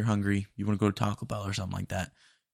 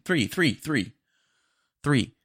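irritated repeat; 6 semitones higher, slower than usual. Acted. Frustrated customer repeating order.

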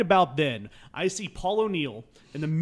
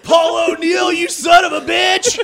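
full-volume, high-pitched retold yell; taunting shout; 14 semitones higher, 12 dB louder, slower than usual. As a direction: holler the insult across a ballpark, stretching it out. Taunting player in retold ballpark scene.

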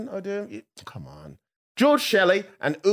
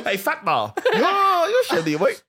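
mock taunt; 11 semitones higher, 4 dB louder than usual. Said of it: "I was just like".

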